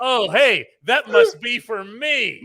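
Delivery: high, loud, stretched-out mock delight; acted eager reaction. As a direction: play up hearing your own ringtone. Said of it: "And Mom's like".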